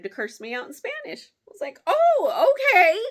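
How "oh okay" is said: squeaky high mock surprise; high surprised okay. Reacting to being cursed out, high mock shock. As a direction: act shocked, high and loud.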